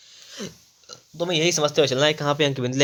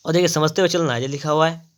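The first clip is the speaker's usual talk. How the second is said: usual speaking voice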